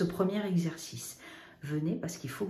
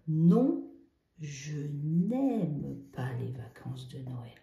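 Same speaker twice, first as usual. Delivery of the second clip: slow emphatic negation; 3 semitones lower, slower than usual. Shown as a demonstration. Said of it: "je dis non".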